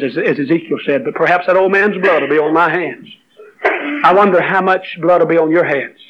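normal talking voice

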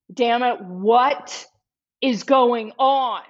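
drawn-out mock exasperation; retold angry demand. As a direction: act out a frustrated demand, word by word. Acting out man standing up, upset.

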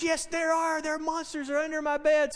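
mild mock panic; frantic little boy. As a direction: imitate a worried kid insisting. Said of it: "not to be so frantic".

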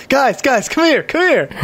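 high, urgent call to friends. Acted. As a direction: call them over urgently. Calling to companions urgently.

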